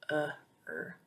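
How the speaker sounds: uh and er sounded separately, softly; isolated vowel sounds. Shown as a demonstration. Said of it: "this sound is always stressed".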